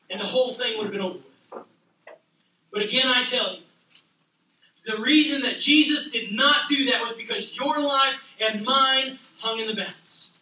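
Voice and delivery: normal talking voice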